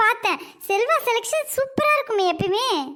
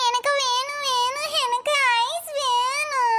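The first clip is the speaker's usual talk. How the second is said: slow, drawn-out wailing whine; child crying; 3 semitones higher, slower than usual. Acted. Child nagging mother for ice cream, crying whine. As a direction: cry and stretch out each plea. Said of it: "ஏன்டா குட்டி அழுவுற?".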